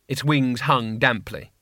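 usual speaking voice

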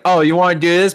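hammed-up tough guy; mock challenge. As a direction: taunt him into a fight. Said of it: "Kevin James like".

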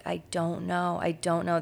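mock sobbing. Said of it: "he's just sobbing".

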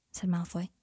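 ordinary delivery, nothing marked

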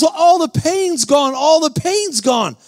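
high, drawn-out cry of relief; amazed outcry. Retold or imitated. Healed man telling the preacher, acted high amazement. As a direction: imitate someone stunned by sudden healing.

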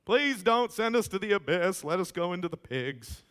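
high, loud, pleading demon voice; begging demons. Retold or imitated. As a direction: beg like a cornered demon for mercy.

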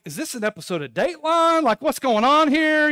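high, loud incredulous questioning; annoyed disbelief. Retold or imitated. Man in a stall thinks his neighbor is creepy, annoyed and high. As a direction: push the pitch up, loudly bewildered. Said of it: "I'm kind of annoyed".

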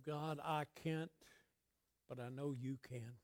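low, very soft admission to God; hushed prayer. Acted. Preacher voicing a believer crying out to God, hushed. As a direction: pray it quietly, far under preaching volume. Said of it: "cried out to God lately from the standpoint".

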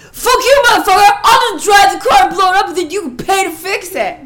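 screamed, high and loud, all rage; furious shout. Retold or imitated. Retelling her son screaming at his brother about tax. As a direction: yell it at your brother like you'll wreck his car.

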